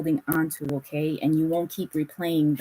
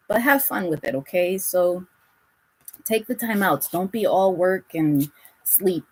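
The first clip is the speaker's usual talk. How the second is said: usual speaking voice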